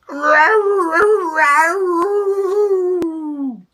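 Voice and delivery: high, loud wailing cat yowl. Acted. Stuck cat yowling for help, high and loud. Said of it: "he yowled".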